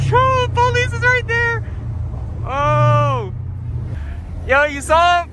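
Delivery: high, loud alarmed shout; excited shouting. Real, not acted. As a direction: yell out in alarm. Spots police during drive.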